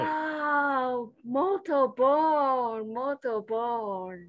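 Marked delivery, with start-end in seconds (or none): 1.2-4.2 slow, careful "muito bom" in Portuguese. Shown as a demonstration. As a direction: savour each word of "muito bom" slowly, with a Portuguese accent. Showing off Portuguese.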